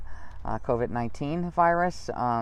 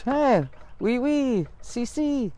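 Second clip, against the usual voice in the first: higher, playful faux French and Spanish; mock foreign words; 11 semitones higher, much slower than usual. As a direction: toss the words off as a joke. Jokey mock foreign-language words.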